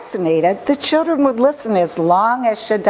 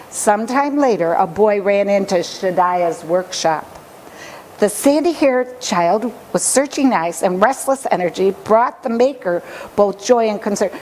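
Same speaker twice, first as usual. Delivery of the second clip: ordinary delivery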